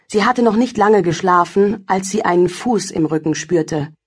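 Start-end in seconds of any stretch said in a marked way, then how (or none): none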